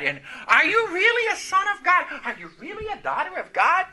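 high, swooping mimicked questions; mock taunting doubt. Preacher voicing doubts about being God's child, high mock taunting.

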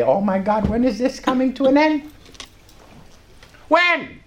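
rising to a high, pleading finish; exasperated plea. Retold or imitated. Preacher replays talking to himself, exasperated plea. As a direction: climb in pitch toward desperation, retold.

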